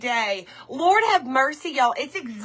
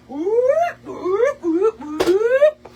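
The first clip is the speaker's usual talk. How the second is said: excited whooping; 9 semitones higher, 5 dB louder, much slower than usual. Real, not acted. Cheering while grabbing her chair.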